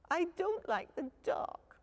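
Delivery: high, small frightened cub voice; Little Bear. A narrator's reading. Little cub telling dad he's scared. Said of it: "said Little Bear".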